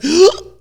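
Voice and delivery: sharp high gasp of dismay. Retold or imitated. Speaker seeing certification demands, sharp dismayed gasp. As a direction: gasp at the paperwork. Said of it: "I was like".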